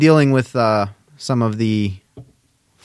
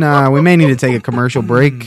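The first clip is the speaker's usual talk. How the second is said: ordinary delivery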